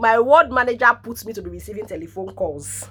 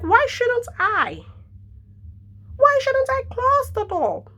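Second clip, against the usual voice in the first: very high, slow, defiant neighbour; high mock defiance; 14 semitones higher, slower than usual. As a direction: stretch her refusal out high and slow. Imitating lady refusing.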